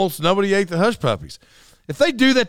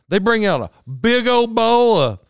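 high, loud impression of wife's country talk; hammed-up country wife; imitating his wife; 7 semitones higher, 4 dB louder than usual. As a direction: mimic wife relishing each big helping. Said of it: "That's exactly how she said it".

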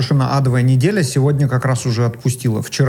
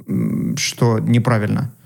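ordinary delivery

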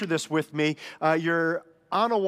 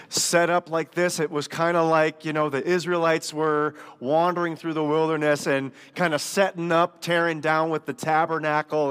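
ordinary delivery

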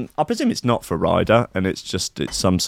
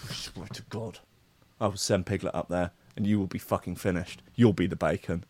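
quiet, deadpan intimidation; hushed threat; 2 semitones lower, 11 dB quieter than usual. Acted. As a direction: threaten under your breath. Acting Pooh threatening with Piglet.

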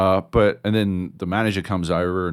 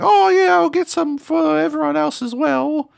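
put-on much higher and louder, bright kid; eager kid voice; 4 dB louder than usual. Kid offering to buy lollies for everyone, high eager voice. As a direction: chirp it like a pleased little boy. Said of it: "he's like".

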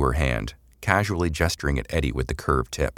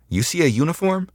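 mock-puzzled question, pitched much higher; feigned bemusement; 13 semitones higher than usual. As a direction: play innocent, teasing. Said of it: "Samantha feigned bemusement".

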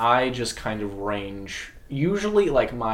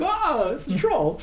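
put-on much higher, slow, charmed old-folks voice; delighted retiree. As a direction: imitate a tickled retiree pointing. Retirees spotting the troll.

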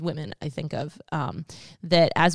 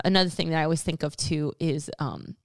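ordinary delivery

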